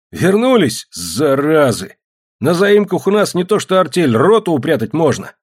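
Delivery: loud, high-pitched bluster; rough hearty hailing. A narrator's reading. Neighbour greets returned rascals, offers hiding places, hearty shouting. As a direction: holler it like a rough welcome. Petrovan hails the returned men.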